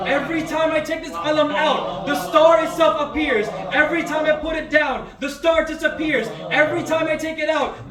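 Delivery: loud, high, booming declaration; commanding proclamation. Acted. Preacher voicing Imam Ali, booming his command to Marhab. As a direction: proclaim it like a hero's decree, rising and ringing.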